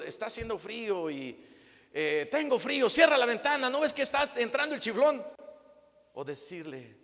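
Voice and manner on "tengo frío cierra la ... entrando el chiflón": whiny demand. Acted. Spouse complaining demandingly.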